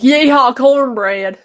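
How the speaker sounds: teasing mock Southern holler; mimicked country accent. Co-host picturing friend home after work, teasing country accent. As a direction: imitate a friend's country twang. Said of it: "and you're like".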